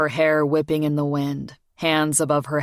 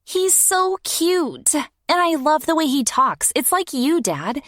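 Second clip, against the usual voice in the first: put-on dreamy young girl; 12 semitones higher than usual. A narrator's reading. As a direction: gush like a smitten kid. Morgan voiced, high.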